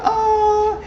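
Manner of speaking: high squeaky mock voice; mock mom. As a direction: play it as a shrill mom caricature. Voicing Mom Lyrica in recap.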